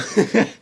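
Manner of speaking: laughing. Real, not acted. Laughter after the church story.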